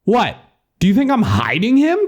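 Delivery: high-pitched sweary tirade; exasperated rant. Acted. Narrator argues with the doubting caller, exasperated. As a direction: rail at him in disbelief.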